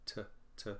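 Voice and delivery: "t" as a tiny soft tuh; short, soft, unstressed. Shown as a demonstration. Teacher showing the unstressed syllable, "t" short and soft. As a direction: barely voice "t", very short. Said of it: "It is short, soft, and lower in pitch".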